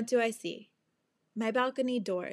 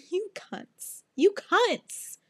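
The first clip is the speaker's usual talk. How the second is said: high-pitched, hammed-up outrage; mock exasperated scolding; 7 semitones higher, slower than usual. Owner to her runaway cats, mock exasperated. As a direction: scold them in a raised, whiny pitch.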